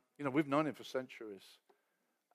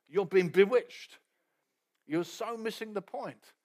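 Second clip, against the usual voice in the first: furious accusation; 3 semitones higher, 5 dB louder than usual. Retold or imitated. Paul accusing the Galatians of being bewitched, loud. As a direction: press each word like a verdict. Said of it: "he's furious".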